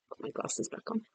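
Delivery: barely voiced, very soft aside; hushed, throwaway remark under the breath; quiet aside. Real, not acted. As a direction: murmur it to yourself. Murmured aside about own glasses.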